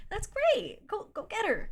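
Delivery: very high, exaggerated encouragement; mock enthusiasm. Telling him to go get his ex, mock enthusiasm. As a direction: cheer him off in a high-pitched voice. Acted retort to his confession.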